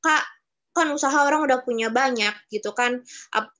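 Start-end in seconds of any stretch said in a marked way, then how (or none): none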